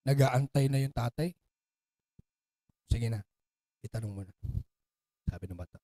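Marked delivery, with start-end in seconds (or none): 2.9-4.3 much softer, lower, patient urging; gentle coaxing. Acted. Father softly urging son to ask, patient and gentle. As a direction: coax the question out gently.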